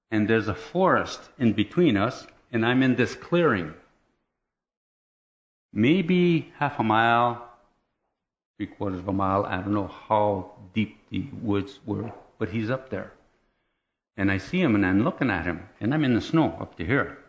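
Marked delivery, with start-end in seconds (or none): none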